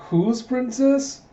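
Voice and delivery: very high, stretched-out question; incredulous. Retold or imitated. Princess questioning her guard's claim, incredulous. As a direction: squeak up in mock disbelief.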